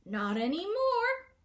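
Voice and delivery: very loud, high, slow roar; roaring tantrum. A narrator's reading. Gnome king roaring back at the hidden gnome, loud tantrum. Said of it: "roared Glimfinkel".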